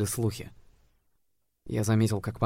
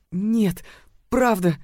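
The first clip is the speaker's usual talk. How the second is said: put-on timid girl's reply; 11 semitones higher, 6 dB louder, much slower than usual. A narrator's reading. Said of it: "тихо проговорила она".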